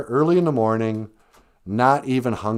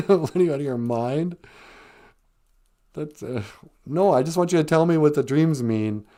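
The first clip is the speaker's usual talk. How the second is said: incredulous protest; 5 semitones higher than usual. Retold or imitated. Balking at fasting in retold scene.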